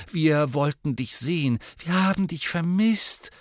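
put-on earnest girl pleading, voice rising; Hermione, trembling voice. A narrator's reading. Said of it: "sagte Hermine mit bebender Stimme".

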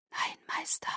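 unison whisper, toneless and soft. A narrator's reading. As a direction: breathe it as one voice. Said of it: "flüsterten sie wie aus einem Mund".

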